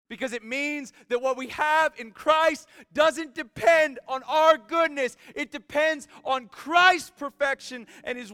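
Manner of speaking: loud, high-pitched impassioned preacher; full-force preaching. Real, not acted. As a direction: drive each phrase home at a near shout.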